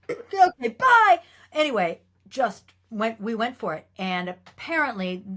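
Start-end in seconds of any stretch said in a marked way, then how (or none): 0.1-1.2 high, loud mock-crying goodbye; hammed-up tearful bye; mock wail. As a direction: wail it like a doomed newcomer. Mock farewell after upsetting the star.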